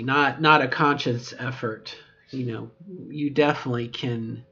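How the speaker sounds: normal talking voice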